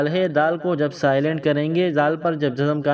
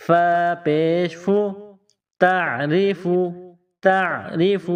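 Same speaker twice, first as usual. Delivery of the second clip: تعریف spelled and joined deliberately; slow spelling drill; 2 semitones higher, much slower than usual. Shown as a demonstration. Teacher joining the word for pupils. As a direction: join syllables with care. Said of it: "فا کے اوپر پیش ہے".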